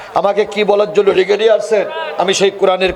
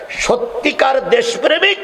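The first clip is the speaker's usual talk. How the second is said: high, ringing rally shout; full-force preaching; 11 semitones higher than usual. Real, not acted. Preacher urging parents toward the student organisation, high impassioned shout. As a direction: proclaim it to the crowd at full pitch.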